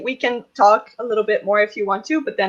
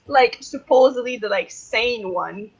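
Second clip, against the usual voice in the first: ordinary talk, nothing marked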